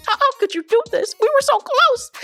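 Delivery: high, whiny mock crying; mock tearful. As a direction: wail it like a betrayed friend, hammed-up. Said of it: "Alandria already had the tears".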